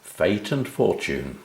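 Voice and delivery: ordinary delivery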